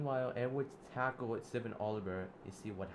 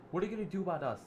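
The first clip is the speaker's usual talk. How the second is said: high, loud defiant jeer; taunting challenge; 8 semitones higher, 6 dB louder, much faster than usual. Acted. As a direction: dare him to act. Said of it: "Zip Oliver said".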